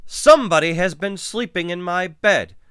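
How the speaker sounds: high, loud bear bellow. A narrator's reading. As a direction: bellow it in outrage. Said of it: "Papa Bear bellowed".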